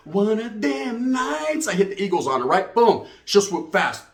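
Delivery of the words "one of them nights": stretched out very slowly, savoring it; slow drawl. Real, not acted. Savoring the memory for the crowd, very slow. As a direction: draw it out like a punchline.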